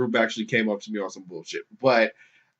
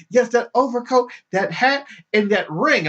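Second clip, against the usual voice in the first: much higher mock insistence; insistent protester; 10 semitones higher than usual. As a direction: hammer home the list. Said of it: "she kept on saying it".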